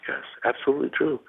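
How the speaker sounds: hushed but high-pitched confirmation; voice kept down. Real, not acted. Caller in a hotel room confirming it, voice kept down. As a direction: drop the volume, still insistent. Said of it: "told to keep my voice down".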